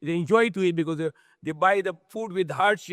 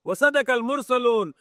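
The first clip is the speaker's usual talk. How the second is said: recited verse; 5 semitones higher, much faster than usual. Acted. Quran verse recited, then repeated.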